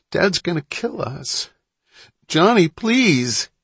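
high, whining sob, building to a drawn-out plea; tearful pleading. A narrator's reading. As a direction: beg your brother through tears. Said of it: "Malin sobbed loudly".

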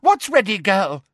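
forceful high-pitched questioning; demanding. A narrator's reading. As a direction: press harder, impatiently. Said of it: "demanded Umbridge".

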